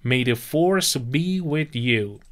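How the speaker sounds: said very slowly, falling at the end; slow and clear for the class; slow model phrase. Shown as a demonstration. Teacher repeats the blessing for students, very slow. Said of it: "حالت دعایی داره".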